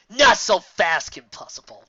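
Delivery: lightly voiced gloating villain, loud start; villain's challenge. A narrator's reading. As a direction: open big, then drop off on the name. Villain confronts the heroine.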